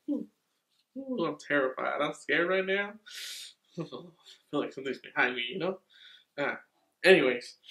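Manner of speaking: ordinary delivery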